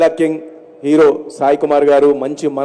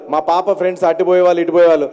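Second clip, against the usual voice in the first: usual speaking voice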